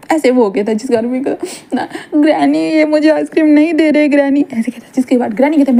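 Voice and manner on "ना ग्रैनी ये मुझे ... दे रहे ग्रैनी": tattling to granny. Acted. Wing complains to granny.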